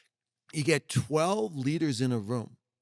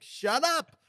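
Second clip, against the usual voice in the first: sharp, much higher mock retort; blunt shut up; 6 semitones higher, 4 dB louder than usual. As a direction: snap it like you're fed up with excuses. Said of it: "I tell people all the time, just, like".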